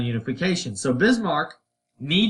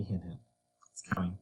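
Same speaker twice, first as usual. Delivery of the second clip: teasing aside; 8 semitones lower, 14 dB quieter, faster than usual. Real, not acted. Teacher's teasing aside to class.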